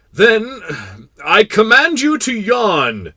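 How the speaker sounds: loud, high, slow decree; commanding king. Acted. King ordering the tired prince to yawn, commanding. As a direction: proclaim it as a royal order.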